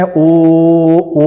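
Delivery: "ओ" drawn out and leaned on; stress on ओ. Shown as a demonstration. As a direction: open the chant on a full "ओ". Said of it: "ओ पे ज़्यादा जोर दे रहे हैं". ("ओ" पर ज़ोर देकर उच्चारण)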